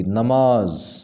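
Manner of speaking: نماز with an a first: na-maaz; correct pronunciation. Shown as a demonstration. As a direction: open نماز on "na". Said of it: "نون کے اوپر زبر ہوگی". (نماز کا صحیح تلفظ، نون پر زبر)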